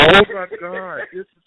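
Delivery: loud, high burst of joy; delighted shout. Real, not acted. Guest hears the fan texted nonstop, loud joyful shout. Guest overwhelmed by fan's love.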